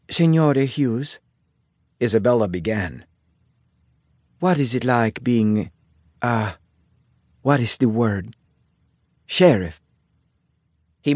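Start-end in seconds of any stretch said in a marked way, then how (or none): 0.1-1.2 put-on slow, Italian-accented address; heavy Italian accent. A narrator's reading. Isabella begins a question to the sheriff, Italian accent. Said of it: "Isabella asked in her heavy Italian accent". 4.4-9.8 put-on halting Italian-accented English; heavy Italian accent. A narrator's reading. Isabella gropes for the word sheriff, Italian accent. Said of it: "Isabella asked in her heavy Italian accent".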